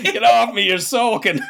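hammed-up complaint breaking into laughter; mock disgust. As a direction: play up the revulsion, loudly. Joins in mock rejection.

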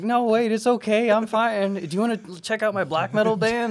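high, loud eager plea; high pleading voice. Retold or imitated. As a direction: chase them down sounding sweet. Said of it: "and I'm like".

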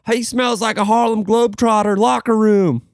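high, mocking good-old-boy voice; Southern coworker quote. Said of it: "this guy from Alabama goes".

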